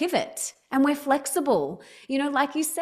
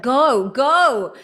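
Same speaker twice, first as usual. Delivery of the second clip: cheering on; 4 semitones higher, 7 dB louder, much slower than usual. Retold or imitated. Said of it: "everyone's gonna be like".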